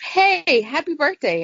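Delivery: put-on a chipper voice for the girlfriend; girlfriend's cheery greeting. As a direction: greet her sweetly and high. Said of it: "she's like".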